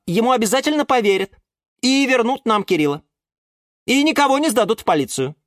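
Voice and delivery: high, self-assured explainer; orchid character. A narrator's reading. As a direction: spell out the clever plan triumphantly. Орхидея излагает план. (высокий уверенный голос орхидеи)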